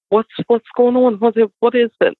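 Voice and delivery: high, fast, frantic questions; frantic mother. Retold or imitated. Mother demanding answers from frightened boys, high and frantic. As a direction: press the boys for answers in a panic. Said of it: "And I'm like".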